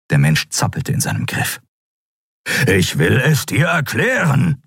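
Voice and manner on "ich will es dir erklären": usual speaking voice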